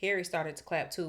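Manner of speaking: ordinary delivery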